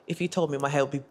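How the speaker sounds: ordinary talk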